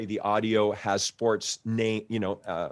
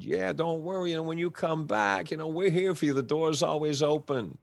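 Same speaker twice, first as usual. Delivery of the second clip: reassuring agent; 6 semitones higher than usual. Retold or imitated. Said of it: "agent who had said".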